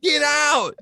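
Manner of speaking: high, urgent shouted warning; frantic yell. Retold or imitated. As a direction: yell at her to run. Yelling at screen, Aunt May.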